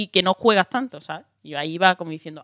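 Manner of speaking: normal talking voice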